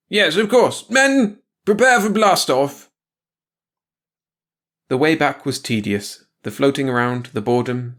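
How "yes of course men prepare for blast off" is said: high, loud order to the crew; a commander shouting orders to his crew; barked command. Acted. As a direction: call the men to launch, slow and loud.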